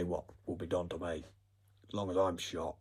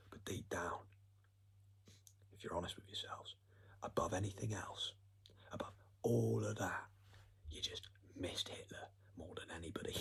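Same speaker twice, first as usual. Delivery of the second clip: softer, voiceless, conspiratorial whisper; mock confiding whisper; 9 dB quieter than usual. Teasing the audience about Hitler, in a hushed whisper. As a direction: lean in and whisper it like a dark secret.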